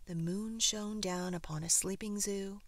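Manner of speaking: ordinary delivery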